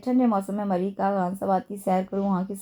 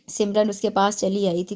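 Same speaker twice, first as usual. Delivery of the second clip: plain reading voice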